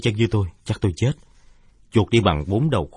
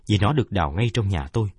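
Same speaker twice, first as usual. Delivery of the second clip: ordinary delivery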